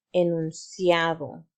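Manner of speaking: enunciado said slowly, syllables clear; slow model word. Shown as a demonstration. Teacher models enunciado for the class to repeat, slow and clear. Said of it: "repite conmigo".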